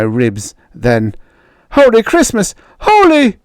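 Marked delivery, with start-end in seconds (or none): 1.7-3.4 high, loud startled cry; shocked yell. Acted. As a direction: caught off guard by a blow. Bully shocked, hurt.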